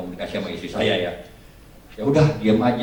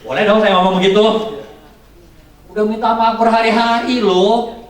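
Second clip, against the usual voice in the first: indignant protest; 11 semitones higher, 7 dB louder than usual. Acted. Acts out indignant protest at daughter.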